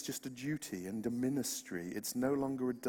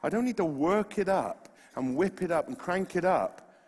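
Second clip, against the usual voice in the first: ordinary talk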